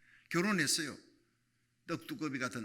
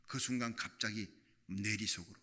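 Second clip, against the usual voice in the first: ordinary talk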